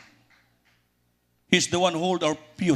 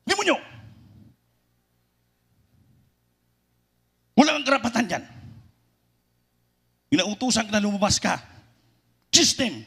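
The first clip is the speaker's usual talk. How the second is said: imitating shouted rebuke; 7 semitones higher, faster than usual. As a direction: order the demon out with force. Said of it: "sabi niya".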